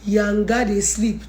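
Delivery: ordinary delivery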